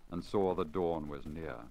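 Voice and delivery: usual speaking voice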